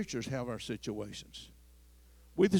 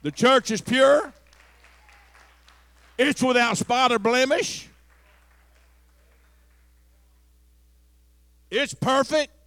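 louder, higher preaching, building; preaching at full force; 9 semitones higher, 11 dB louder than usual. Acted. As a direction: drive it home to the congregation.

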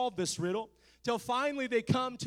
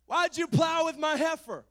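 higher, louder indignant outburst; angry Samson; 8 semitones higher, 8 dB louder than usual. Acted. Samson confronting the Philistines, angry and indignant. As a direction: fling the accusation at them. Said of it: "Samson was so angry".

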